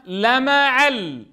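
لمعل said slowly, every syllable stretched; slow blended word. Shown as a demonstration. Teacher blending the spelled syllables for the class. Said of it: "شو صارت الكلمة؟".

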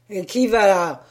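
loud startled who-goes-there; startled challenge. Acted. Sailor startled by the donkey, loud challenge. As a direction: jump back and call out.

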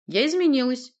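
high, loud, firm statement; unhesitating. A narrator's reading. Sorceress answers the doubting boyar, unhesitating. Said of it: "не колеблясь сообщила Власта".

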